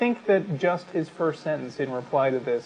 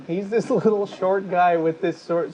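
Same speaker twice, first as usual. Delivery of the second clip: giggling through the words, pitched up; amused, half-laughing description; 6 semitones higher, 4 dB louder than usual. Real, not acted. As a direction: laugh as you describe him. Laughing while calling Dawkins cute.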